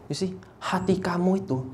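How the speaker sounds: ordinary delivery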